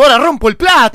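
furious shout. Acted. Breaking a plate, cursing.

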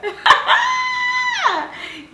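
shrieking burst of laughter; loud high laugh. Real, not acted. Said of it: "not me trying to speak French".

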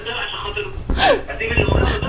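laughing. Real, not acted. Husband laughing.

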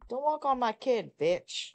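ordinary talk, nothing marked

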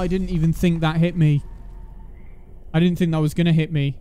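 loud exasperated protest. Real, not acted. Outraged at being hit.

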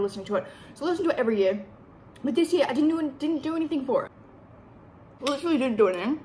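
chewing while talking; eating between words; mouth full. Real, not acted. Seen 0: Bite at -1.0; talks with food, holding spoon, until ~1.1.

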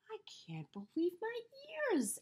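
breathless unvoiced amazement; astonished whisper. A narrator's reading. Elephant marvelling at the talking melon, astonished whisper. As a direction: whisper it in disbelief. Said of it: "Elephant exclaimed".

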